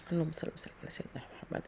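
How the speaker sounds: prayer breathed out in a whisper. Real, not acted. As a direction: whisper the blessing to yourself. Whispered prayer while cooking.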